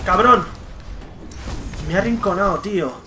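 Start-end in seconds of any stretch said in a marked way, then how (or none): 0.0-0.5 loud frustrated swear; angry curse. Real, not acted. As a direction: bark the insult in anger. Cursing the enemy player.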